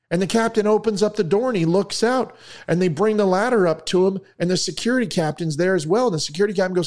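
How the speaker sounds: high-pitched, escalating storytelling; animated joke telling. A narrator's reading. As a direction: tell it with mounting excitement. Excited joke narration.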